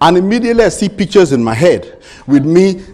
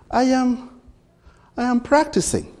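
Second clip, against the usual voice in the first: a lightly voiced small girl, higher; little daughter; 8 semitones higher, 4 dB quieter than usual. Daughter correcting her daddy, lightly voiced higher child. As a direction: play the four-year-old explaining herself.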